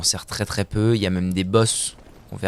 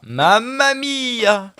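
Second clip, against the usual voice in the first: very high, long drawn-out wail; alarmed mamma mia; 13 semitones higher, 5 dB louder, much slower than usual. Real, not acted. Player with an enemy behind, hammed-up high alarm. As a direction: stretch it into a high cry.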